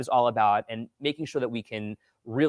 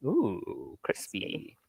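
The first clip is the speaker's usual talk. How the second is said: soft breathless crispy; whisper; 4 semitones lower, 9 dB quieter, much slower than usual. Real, not acted. Echo of comment.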